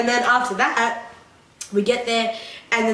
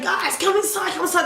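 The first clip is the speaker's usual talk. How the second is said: retold urging; 7 semitones higher than usual. Retold calling friends inside.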